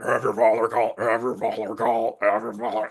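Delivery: mildly eerie droning repeat; weird repeating voice. Retold or imitated. Mirror repeating through the phone, mildly eerie. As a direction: loop it like a haunted recording. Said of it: "with this really weird voice".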